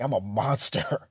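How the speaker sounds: slow, hammed-up self-pity with a laugh; mock despair. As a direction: play a tragic monster, tongue in cheek. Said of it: "I'd be like".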